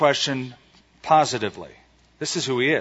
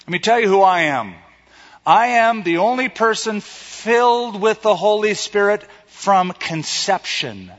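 proud boast; 6 semitones higher than usual. Retold or imitated. John imagined boasting again.

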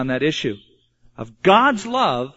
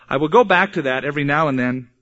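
ordinary talk, nothing marked

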